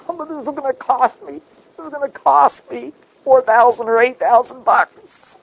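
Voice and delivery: high, whining student complaining about money; mock-whine the lost scholarship; whiny student.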